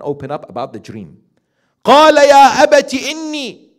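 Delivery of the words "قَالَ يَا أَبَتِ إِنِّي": fast, chanted recitation, much higher and louder; Quranic recitation. Acted. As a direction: break into recitation of the verse. Quran verse recited mid-sermon.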